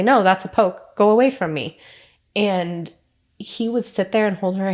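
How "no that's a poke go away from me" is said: imitating a small child's loud protest; mock yell, louder and emphatic, pushing the nurse away; retold child's yell. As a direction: act out a frightened kid's refusal. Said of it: "She would yell and scream and say".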